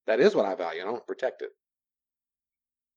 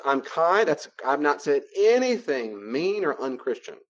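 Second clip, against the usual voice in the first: ordinary talk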